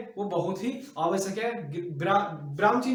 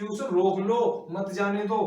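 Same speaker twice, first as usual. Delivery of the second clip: slow, louder रोक लो, then मत जाने दो; break after रोक लो; 4 dB louder, slower than usual. Shown as a demonstration. Teacher recaps the second sentence's meaning, stressed रोक लो. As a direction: punch रोक लो, then keep मत जाने दो together. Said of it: "second में हमें अर्थ मिला है".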